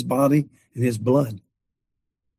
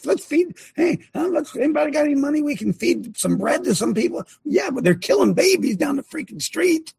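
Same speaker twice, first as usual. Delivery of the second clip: mocking; 10 semitones higher than usual. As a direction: scoff at the comfortable flock, pitched up high. Mocking comfortable churchgoers.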